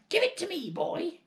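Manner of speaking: very high, loud hag yell; yelled demand. Acted. Grandma yelling at George for the cup, high hag yell. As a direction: screech the demand at him. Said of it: "yelled Grandma".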